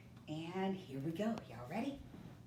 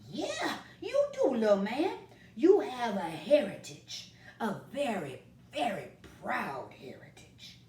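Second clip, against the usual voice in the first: kindly grandpa; 5 semitones higher, 7 dB louder than usual. Acted. Grandpa voiced in story.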